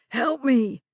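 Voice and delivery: put-on loud panicked old man; frightened plea. A narrator's reading. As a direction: beg for rescue. Confused old man pleading.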